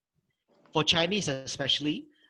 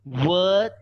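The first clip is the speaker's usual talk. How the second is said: emphatic word; 7 dB louder than usual. Shown as a demonstration. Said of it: "how should you actually pronounce".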